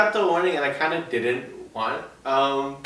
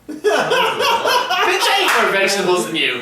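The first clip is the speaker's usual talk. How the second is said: higher, louder, slow mock-indignant laugh-shout; laughing retort; 13 semitones higher, 5 dB louder, slower than usual. Real, not acted. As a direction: fire back at the teasing through laughter. Retort to ad teasing.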